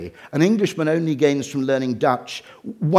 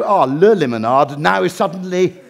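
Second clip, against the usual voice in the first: loud, higher mock French dawning realisation; a hammed-up French voice, louder and higher; mock French waiter; 4 semitones higher, 5 dB louder than usual. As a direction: ham up a Frenchman suddenly getting it. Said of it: "they go".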